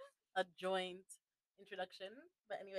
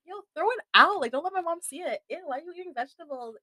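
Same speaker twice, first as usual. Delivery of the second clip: high-pitched mock disgust; imitated grossed-out friends; 11 semitones higher, 6 dB louder than usual. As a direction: play a horrified kid reacting to vegetables.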